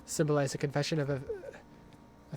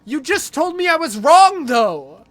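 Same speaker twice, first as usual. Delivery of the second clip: high, shouted objection; indignant protest; 10 semitones higher, 13 dB louder than usual. Real, not acted. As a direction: shout back at an unfair ruling. Arguing back at the game.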